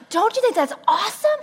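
high eager question; mock eager husband. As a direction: fish for approval.

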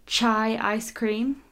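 ordinary talk

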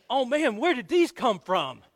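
high, slow, loud fake worry; mock alarmed attendant. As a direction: ham up a fake panic over loose bolts. Ride attendant fakes alarm as joke.

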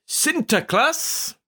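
put-on slow, high Dutch accent on Sinterklaas. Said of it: "Very Dutch".

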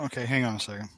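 faint, distant voice off mic; turned away from the microphone; far from mic. Real, not acted. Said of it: "it sounds like you're far away".

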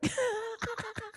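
high, soft giggle; high little laugh, trailing away. Real, not acted. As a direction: giggle quietly at your own joke. Laughs at her own plan.

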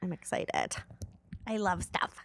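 breathy excited whisper. Real, not acted. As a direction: whisper it like a secret. Whispered aside.

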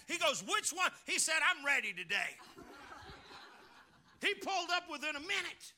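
full-force preaching. Acted. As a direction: preach it out big to the congregation.

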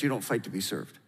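normal talking voice

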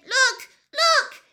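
high, loud, fussy fish; upset fish voice. Acted. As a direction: play a panicky little fish, loud and high. Fish shrieking.

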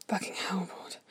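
spent, whispered curse; breathless, voiceless exhale of words; panting. A narrator's reading. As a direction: pant it out in a soft whisper. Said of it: "he pants".

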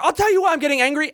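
fast, high-pitched mock anger; angry. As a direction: imitate a man snapping back, worked up. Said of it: "why you're getting so angry".

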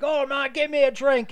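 high, loud mock barfly calling for a drink; hammed-up drinker shouting for a drink; boisterous bar guy. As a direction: ham up a rowdy regular ordering. Retelling the heist movie scene.

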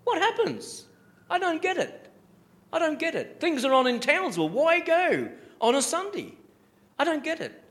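high, mock-baffled "I don't get it"; mocking churchgoer. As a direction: play the shrugging believer who skips church. Preacher mocks churchgoer skipping Sunday.

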